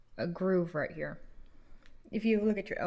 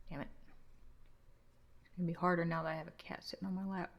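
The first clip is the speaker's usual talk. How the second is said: ordinary delivery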